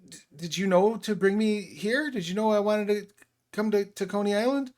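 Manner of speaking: put-on jittery teenager rambling sorry; nervous teenage boy. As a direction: stammer out the apology, worried. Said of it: "the teenage boy says".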